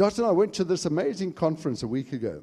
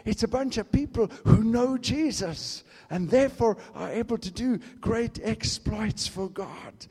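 ordinary talk

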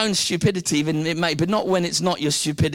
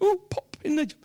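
casual British quip; 11 semitones higher than usual. Retold or imitated. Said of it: "we make it very casual".

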